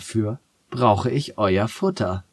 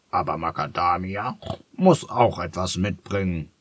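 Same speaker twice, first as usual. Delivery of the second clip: pig calling. Acted. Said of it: "rief das gefleckte Schwein".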